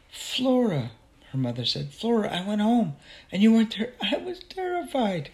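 high, loud, distressed outpouring; frantic mother. Acted. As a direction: voice climbing high as a worried mother. Mother's frantic relief at finding Flora.

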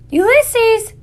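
high, ringing holler; shouted call. Acted. Girl searching the woods for her lost squirrel, shouted call. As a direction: shout the name out into the distance. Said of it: "shouted Flora".

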